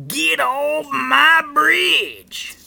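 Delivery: high, slow, very loud scream; horrible troll scream. A narrator's reading. Troll orders the goat off, drawn-out horrible scream. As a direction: scream it out, stretching every word. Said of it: "he screamed in a horrible voice".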